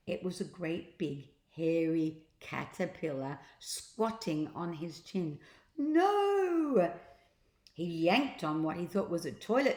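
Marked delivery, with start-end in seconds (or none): 5.8-6.9 high, loud drawn-out no; horrified cry. Acted. Captain discovering a caterpillar on his chin, loud horrified no. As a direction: recoil in comic horror.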